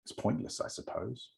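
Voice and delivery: whispered, trailing off at the end; soft fading close. Acted. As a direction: let the line dissolve into a breath. Reading the poem's closing line.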